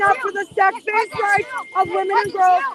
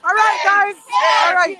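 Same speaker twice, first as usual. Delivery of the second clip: calling out; 8 dB louder than usual. Real, not acted. Calling out to group.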